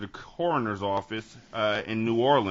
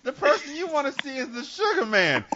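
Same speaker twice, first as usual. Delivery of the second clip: high, loud, pleading seventies movie snitch; mock panicked informant; 13 semitones higher, 5 dB louder than usual. Informant begs not to be beaten up, mock panic. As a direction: plead in a pitched-up put-on voice.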